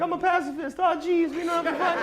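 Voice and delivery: high, nasal-free mock whine of a timid friend; nerdy friend voice. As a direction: squeak it out like a nervous pacifist. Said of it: "He was like".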